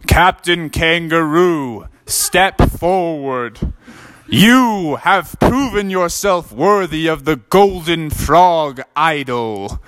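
slow, grand knight voice; an old knight bestowing the prize, slow and grand; solemn knight. Acted. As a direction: proclaim it slowly like a ceremonial knight. Knight grants the idol.

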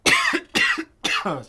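coughing and choking mid-sentence. Real, not acted. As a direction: choke and cough through the words. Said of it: "sorry, I'm choking".

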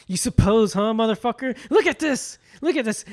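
high, loud acted tirade; mock furious yelling. [name] berating Andy over the camera, mock angry yell. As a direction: build to a high shouted demand.

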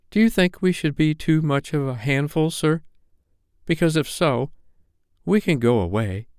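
high-pitched little twin, hurt pride; proud, flushing boy. A narrator's reading. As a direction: ask it as a proud boy embarrassed before a grown-up. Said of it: "the proud one, and he asked, flushing".

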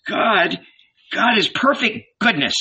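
high, earnest protest; objecting. A narrator's reading. John answers the red beard back, objecting. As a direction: object fervently, pitched well up. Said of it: "John objected".

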